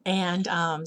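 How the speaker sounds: ordinary talk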